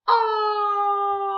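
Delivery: "ah" held long, high and loud; vowel sound demo. Shown as a demonstration. Teacher leads class saying the sound, long loud "ah". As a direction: stretch "ah" into one loud high open vowel. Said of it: "let's say it".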